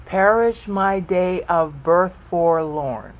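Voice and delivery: slow, measured verse with each syllable set apart; counting out syllables. Shown as a demonstration. Teacher reads verse to count out syllables, slow and measured. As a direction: read it slowly, beat by beat. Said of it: "Count out the syllables".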